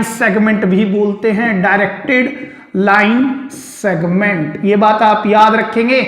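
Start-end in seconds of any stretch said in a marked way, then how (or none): 1.6-4.6 slow dictation. Shown as a demonstration. Teacher repeats term for notes.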